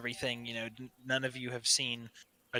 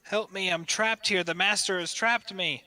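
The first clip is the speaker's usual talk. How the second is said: raised, begging plea; pleading dragon; 7 semitones higher, 6 dB louder than usual. Acted. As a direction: plead to be freed. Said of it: "in a booming dragon voice".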